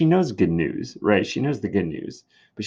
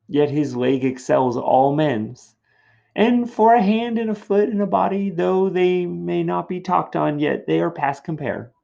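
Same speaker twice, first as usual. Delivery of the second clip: teasing nurse; 6 semitones higher, 4 dB louder than usual. Acted. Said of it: "So the nurse responds".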